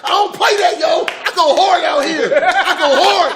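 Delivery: high, laughing bragging shout; hyped rookie. Retold or imitated. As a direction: brag hard, laughing, up high. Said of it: "rookie hyped".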